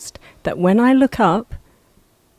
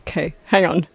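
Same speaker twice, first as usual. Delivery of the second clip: ordinary delivery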